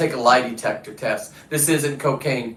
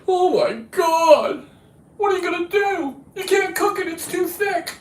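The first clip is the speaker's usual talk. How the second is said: very high put-on whiny voice; mock crying complaint; 14 semitones higher than usual. Mocking people who cry over his catch, high whining imitation. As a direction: mimic a fretting critic way up high. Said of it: "do I sit there and cry about".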